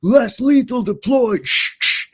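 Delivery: high, loud, slow police announcement, then shushing noises; cop's call-out impression. Arriving officer announcing weapon to others, mimicked loud call-out. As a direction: call it out drawn-out, add two hisses. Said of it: "like that".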